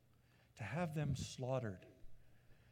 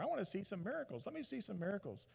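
softer, fast mocking demand; taunting demand; 5 semitones higher, 6 dB quieter, faster than usual. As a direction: needle him for a trick. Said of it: "Herod goes".

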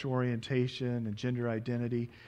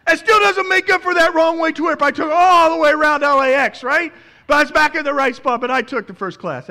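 loud, high, breathless retelling of the airport rush; excited preaching story; 17 dB louder than usual. Acted. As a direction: preach the panic of running late at full force.

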